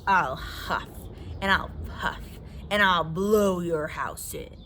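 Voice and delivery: bare teeth and growl the threat; menacing coyote. Acted.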